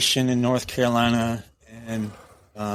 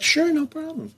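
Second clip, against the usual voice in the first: squeaky, much higher put-on woman's voice; mock high secretary voice. Secretary cheerfully agreeing to time-stamp his memo, high falsetto.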